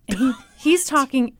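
ordinary talk

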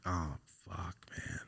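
hushed, whispered dismay; sad. Retold or imitated. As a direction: re-enact a deflated, barely voiced reaction. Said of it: "I was all fucking sad about it".

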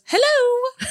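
high-pitched mimicked hello; imitated phone greeting. Brother picking up the sibling's call, high mimicked greeting. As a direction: answer the phone in a high put-on voice. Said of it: "he goes".

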